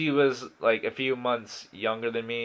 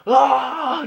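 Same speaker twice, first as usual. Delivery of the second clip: mock yell; 12 semitones higher, 6 dB louder than usual. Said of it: "he's like".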